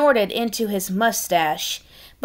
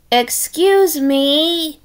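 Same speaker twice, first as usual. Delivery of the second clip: higher, very slow timid voice; shy stranger; 6 semitones higher, 3 dB louder, much slower than usual. Acted. Disguised pig approaching the birds, slow timid voice. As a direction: ease in nervously.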